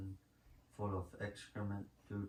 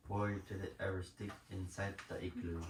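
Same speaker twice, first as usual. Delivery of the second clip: normal talking voice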